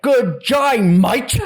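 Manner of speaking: high, loud hammed-up Australian accent; mock Australian accent. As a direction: ham up a bad Australian accent, laughing. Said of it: "We spoke in an Australian accent".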